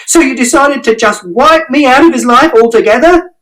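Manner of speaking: very high, accusing yell; outraged shout. A narrator's reading. Mother shouting at father for erasing her. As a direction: hurl the question at him. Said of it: "And Mother shouted".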